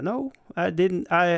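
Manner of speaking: usual speaking voice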